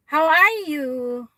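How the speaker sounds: slow, high, loud how are you; excited greeting. Real, not acted. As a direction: sing it out to the viewer. Greeting viewer on live stream.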